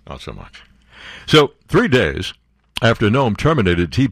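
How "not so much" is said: "Not so much" repeated much softer and lower, as a throwaway; quiet echo. Real, not acted. Host repeats his joke to listeners, much softer and lower. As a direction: mutter "Not so much" again under the breath.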